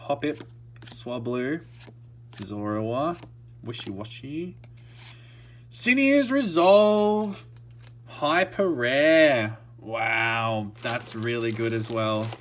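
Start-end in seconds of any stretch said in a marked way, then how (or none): 5.7-9.6 higher and louder, drawn out slowly; announcing a big hit slowly; real excitement, stretched out; excited reveal. Real, not acted. Reveals another rare card.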